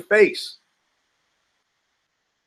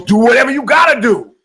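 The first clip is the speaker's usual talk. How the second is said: much higher, louder taunting yell; defiant shout; 8 semitones higher, 8 dB louder than usual. Retold or imitated. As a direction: dare him, loud and cocky. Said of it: "Guy goes".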